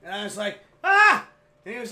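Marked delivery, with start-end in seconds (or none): 0.8-1.3 high, very loud yelp; re-enacted startled scream. Son screams as the door flies open, loud high shriek. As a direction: scream as if someone burst in.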